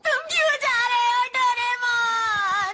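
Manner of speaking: distraught cry. Acted. Boy learns his friend is leaving, distraught wail. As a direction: wail the question, stretching the name.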